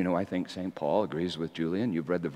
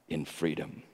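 usual speaking voice

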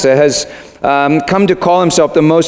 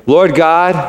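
higher, projected for repeating; out loud; 5 semitones higher than usual. Shown as a demonstration. Pastor leading the congregation in prayer, out loud. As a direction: say it out for all to follow. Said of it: "Let's do it out loud".

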